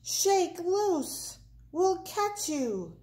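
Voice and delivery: high-pitched calling upward; shouted encouragement. A narrator's reading. Prairie dogs promise to catch Big Bark, shouting. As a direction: urge them on from below. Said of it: "the prairie dog shouted".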